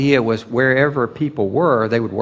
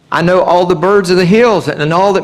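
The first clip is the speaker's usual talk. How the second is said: higher, louder declaration as God; God's proclamation; 6 semitones higher, 5 dB louder than usual. Retold or imitated. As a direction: proclaim it from on high. Quoting God in Psalm 50.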